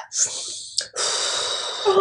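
imitated long, audible calming breath out; deep breath. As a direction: act out a little boy's deep breathing. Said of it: "he was like".

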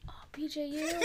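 soft, breathy mock awe; awed whisper. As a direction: hush it like a sacred name.

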